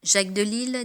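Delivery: ordinary delivery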